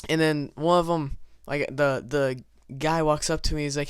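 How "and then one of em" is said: ordinary talk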